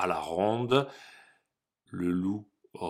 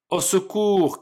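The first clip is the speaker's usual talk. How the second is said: high panicked shout; scared cry for help; 11 semitones higher, 5 dB louder than usual. Acted. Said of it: "crièrent les deux petits cochons".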